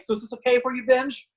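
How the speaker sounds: a hint of the trainer ribbing a student; teasing trainer. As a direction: needle him with mock concern. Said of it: "he's like".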